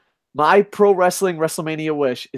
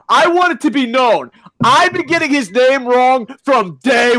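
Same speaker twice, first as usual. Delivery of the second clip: angry rant; 5 semitones higher than usual. Real, not acted. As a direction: yell it all out in indignation. Ranting about Johnny Tampa tweet.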